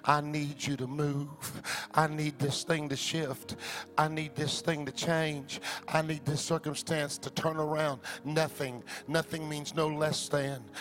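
almost a hush, drawing them in; soft intimate preaching. Acted. Pastor calling the congregation to fast, hushed. Soft, low sermon close.

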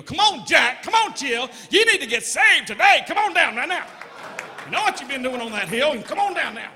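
loud altar-call urging; mock revival preacher; brother preaching. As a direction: call sinners down to be saved. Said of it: "He started preaching about".

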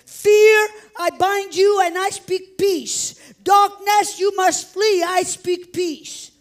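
high, full-force prayer command; shouted, commanding spiritual declaration; loud with authority. Retold or imitated. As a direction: proclaim it forcefully, as if ordering a storm to stop. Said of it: "I spoke loud with authority".

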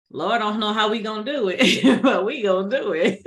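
fast, laughing through the words; half-laughing mock worry; laughing disbelief. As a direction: rattle it off while laughing. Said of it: "I was like".

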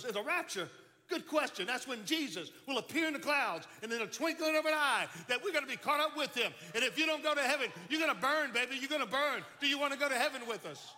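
high, loud preaching at full force; Pentecostal preacher. Retold or imitated. Young preacher sermonizing to a lone dorm-mate, hammed-up Pentecostal preaching. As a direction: thunder it out like a hellfire preacher. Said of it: "here's this Pentecostal preacher's".

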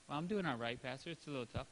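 soft weary reply. Retold or imitated. Congregant answering the pastor, subdued and low.

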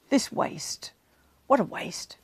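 hushed, whispered complaint; a half-whispered grumble about waste; muttered grumble. Retold or imitated. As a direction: mutter it under your breath, like a resentful disciple. Disciples grumbling about Mary.

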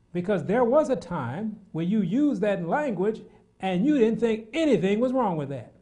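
high, loud, fervent preaching; a preacher hammering home his point; preaching at full force. Acted. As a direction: stir the congregation with rising fire. Preacher addressing congregation.

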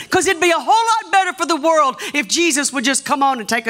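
high, pressing pulpit delivery; urgent preaching. Real, not acted. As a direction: urge the congregation with fervor. Preaches to congregation.

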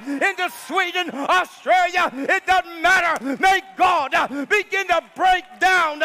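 loud, high, rhythmic declarations with gasped "uh" between phrases; full-force preaching. Real, not acted. Preacher declares war on darkness to congregation, full-force preaching. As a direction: drive each phrase out like a battle cry.

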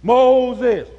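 loud, drawn-out divine call; booming God voice. Retold or imitated. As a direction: call him from the bush like God. Voice of God from bush.

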